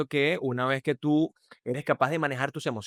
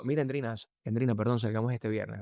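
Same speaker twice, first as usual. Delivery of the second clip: low seductive voice; 3 semitones lower than usual. Shown as a demonstration. Seducing Endrina with an invitation, low resonant voice. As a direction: lower the tone to persuade, unhurried. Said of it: "esa nota de voz tiene una resonancia mucho más baja".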